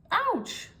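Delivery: very high, sharp ouch; nose tweaked, sharp high yelp; cry of pain. A narrator's reading. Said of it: "cried little Miss Naughty".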